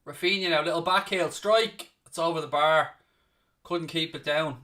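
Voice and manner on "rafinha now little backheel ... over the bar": normal talking voice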